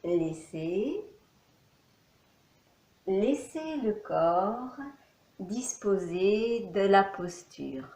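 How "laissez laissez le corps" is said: ordinary talk, nothing marked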